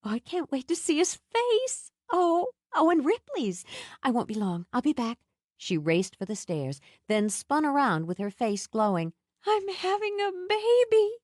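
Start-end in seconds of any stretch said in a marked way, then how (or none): 0.0-5.2 higher, bubbling with joy; excited. A narrator's reading. Nell dancing with joy. 9.5-11.1 much higher, elated cry. A narrator's reading. Nell glowing, spinning round.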